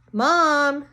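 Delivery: called up loud and high; pretending to call for her mother, loud. A narrator's reading. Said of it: "she called".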